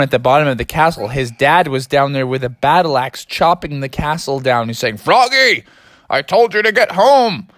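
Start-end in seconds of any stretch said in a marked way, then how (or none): 5.0-7.5 scolding dad. Acted. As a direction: yell up at him like a cross dad.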